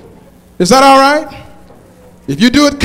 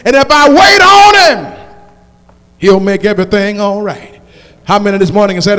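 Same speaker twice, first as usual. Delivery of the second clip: preaching at full force; 6 dB louder, slower than usual. Acted. Preacher rallying the congregation, full-force whooping sermon.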